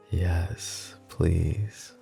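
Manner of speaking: soft, slightly breathless plea; flirtatious relish. Acted. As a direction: savor it quietly, like a smitten flirt. Flirtatiously savoring the imagined biting words.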